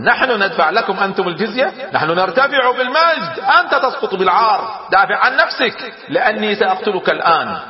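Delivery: high-pitched, indignant outburst; angry. A narrator's reading. Roman commander rejects paying tribute to Khalid, angry and high. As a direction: raise the pitch in proud outrage and threaten. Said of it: "فغضب أدادير".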